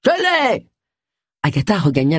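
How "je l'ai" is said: high call of triumph; triumphant shout. A narrator's reading. Snake specialist calling from the garden, high triumphant shout. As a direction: call out the catch. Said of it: "un cri retentit".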